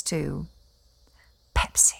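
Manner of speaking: hushed, breathy whisper of a shameful name; scandalized whisper. Acted. Gossiping about her husband's betrayal, scandalized whisper. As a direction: drop to a whisper as if it's gossip. Scandalous secret named in hush.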